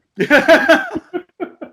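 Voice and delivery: loud high laugh burst; hearty laugh at his own warmth. Real, not acted. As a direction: break into a big laugh. Bursts laughing after hug line.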